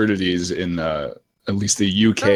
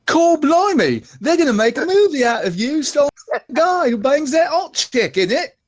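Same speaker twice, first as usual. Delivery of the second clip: much higher, hammed-up British accent; 3 dB louder than usual. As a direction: play an over-the-top Brit, way up high. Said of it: "my voice went up an octave".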